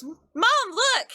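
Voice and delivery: put-on high child's voice calling out to mom; excited child calling. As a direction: call out high, like an excited kid. Child character calls out to mom.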